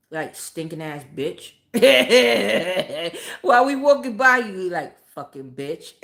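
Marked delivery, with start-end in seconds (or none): 0.1-1.5 low, slow muttered insult; a slow, low mutter with a laugh in it; muttered under breath. Retold or imitated. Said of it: "talk shit under our breath". 4.7-5.9 low, slow muttered insult; a slow, low mutter with a laugh in it; muttered under breath. Retold or imitated. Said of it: "talk shit under our breath".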